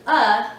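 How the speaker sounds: loud clipped "uh" schwa; schwa sound. Shown as a demonstration. Teacher sounding the schwa in umbrella. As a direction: hit the "uh" cleanly. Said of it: "there's an".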